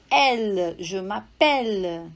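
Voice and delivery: slow m'appelle with an open E, mapɛl. Shown as a demonstration. Teacher to student: slow French m'appelle. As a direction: draw out m'appelle to show the open E. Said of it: "vai ficar aberto".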